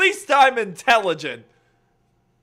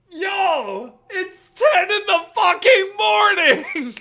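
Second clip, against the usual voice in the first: laughing outrage; 10 semitones higher, 6 dB louder, slower than usual. Real, not acted.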